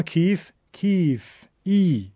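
کیف with long 'ee', then ای held alone. Shown as a demonstration. Teacher contrasting ya with kasra, drawn-out 'ee' in کیف. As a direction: stretch the 'ee' of کیف and isolate it. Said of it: "و یا مدی".

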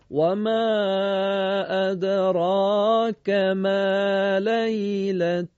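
slow measured recitation; Quran recitation. Acted. Scene: teacher opens the surah for radio listeners, slow recitation. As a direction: recite slowly and evenly.

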